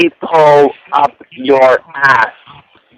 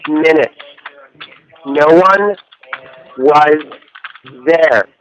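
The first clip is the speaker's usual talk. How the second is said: slow, word by word. Shown as a demonstration. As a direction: dictate each word slowly for the operator to type. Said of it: "please speak slowly".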